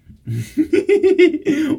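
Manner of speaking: high, loud laugh. Real, not acted. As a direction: burst out laughing at the dog. Dog being silly.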